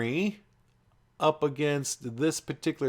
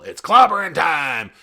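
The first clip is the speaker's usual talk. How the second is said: loud, slow roaring yell; the Thing's battle cry; 3 semitones higher, 11 dB louder than usual. Retold or imitated. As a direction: bellow it like the Thing. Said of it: "He'd just yell".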